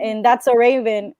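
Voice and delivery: ordinary talk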